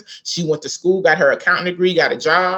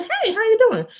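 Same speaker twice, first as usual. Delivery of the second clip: sweet greeting. Retold or imitated. Mistress greets mother sweetly.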